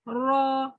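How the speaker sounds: را held briefly on a level note; two-count vowel. Shown as a demonstration. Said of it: "Just two counts".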